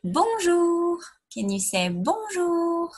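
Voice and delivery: teacher modelling Bonjour in a high voice; French bonjour, high. Shown as a demonstration. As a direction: model Bonjour brightly for the class to echo. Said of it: "do you remember that one".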